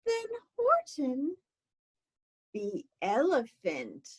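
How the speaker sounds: ordinary delivery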